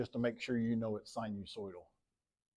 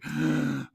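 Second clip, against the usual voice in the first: sharp audible gasp; 3 semitones higher than usual. Real, not acted. Gasps while tracing points on graph.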